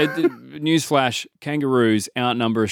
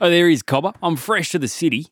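a cheery outback larrikin, laid on thick; broad Aussie bushman; 5 semitones higher than usual. Pretend. Lost bushman greets the cop, hammed-up Aussie accent. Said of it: "the accent is, uh, throwing me off".

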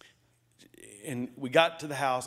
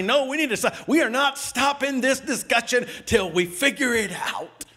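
higher, louder, insistent shout; retold shouting husband; 8 semitones higher, 5 dB louder than usual. Husband shouting at wife he won't stop. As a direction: refuse to stop the fight. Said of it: "I say".

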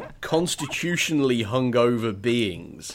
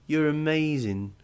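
lightly higher dreamy gush; gently doting, like a stoned hugger; blissed-out alien; 5 semitones higher, slower than usual. Acted. Martian gushing at the humans, lightly dreamy.

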